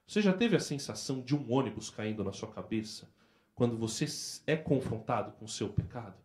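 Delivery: ordinary talk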